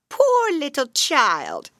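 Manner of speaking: high, slow crone voice; old witch voice. Acted. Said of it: "said the old woman".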